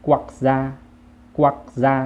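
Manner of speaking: กวักซา as loud Vietnamese, stopped first syllable; Vietnamese pronunciation. Shown as a demonstration. Teacher models the word, Vietnamese pronunciation of กวักซา. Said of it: "ภาษาเวียดก็จะว่า".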